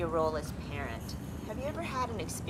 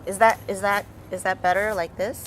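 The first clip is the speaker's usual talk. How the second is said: ordinary talk, nothing marked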